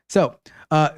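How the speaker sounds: usual speaking voice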